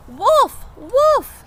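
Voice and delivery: very high, loud fake warning; alarmed cry. Jack crying wolf at the birds, high loud shout. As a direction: cry wolf at the top of your voice. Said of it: "he cried".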